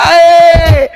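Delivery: a high burst of mocking laughter, then "Eh". Real, not acted. Laughs at guest's screen name. (tawa mengejek bernada tinggi)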